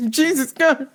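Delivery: high, drawn-out comic alarm; mock horror. Teller watches her in traffic, high mock horror. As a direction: gasp it high, scandalized and spooked. Own alarmed reaction retold.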